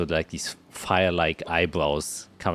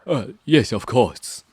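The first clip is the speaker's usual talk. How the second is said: waiter voice; 6 semitones higher, 8 dB louder, slower than usual. Acted.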